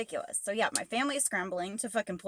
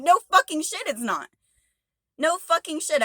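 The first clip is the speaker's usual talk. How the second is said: higher and louder, yelling the words out; furious shout; 7 semitones higher, 6 dB louder than usual. Retold or imitated. Driver yelling back at dispatch, furious shout. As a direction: blast it back at them in full anger.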